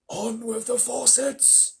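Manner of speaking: slow, high, distant booming call; far-off giant voice, drawn out; faraway voice calling down. A narrator's reading. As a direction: call down from above the clouds. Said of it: "it shouted".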